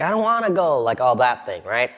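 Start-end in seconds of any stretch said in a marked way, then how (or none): 0.0-0.8 put-on high, whining child; lightly voiced kid whining; whiny kid. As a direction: complain about going to church. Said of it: "they're like".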